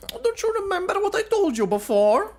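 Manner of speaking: high, sly schemer's voice; high, teasing villain coaxing an ally; scheming W. Acted. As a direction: coax him like a sly mercenary.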